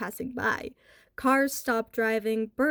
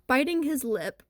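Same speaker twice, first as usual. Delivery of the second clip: usual speaking voice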